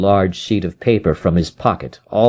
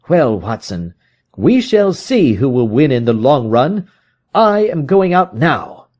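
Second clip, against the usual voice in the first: higher, intent detective voice; Holmes, reasoning aloud; 6 semitones higher than usual. Acted. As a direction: think it through, then resolve.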